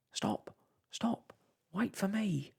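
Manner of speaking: calling after the ship, rising high on the last words. A narrator's reading. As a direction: cry out to stop the departing ship.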